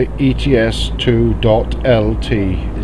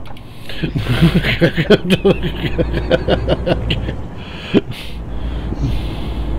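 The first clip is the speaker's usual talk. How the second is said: breathless high laugh; wheezy laugh; 6 semitones higher, 4 dB quieter than usual. Real, not acted. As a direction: laugh without voice. Laughing at own joke.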